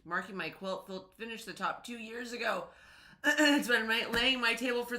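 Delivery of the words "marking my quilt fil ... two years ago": normal talking voice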